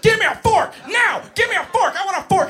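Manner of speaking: high, loud screamed demand; screaming three-year-old. Retold or imitated. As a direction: imitate a preschooler shrieking at point blank. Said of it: "I'm being screamed at point blank range".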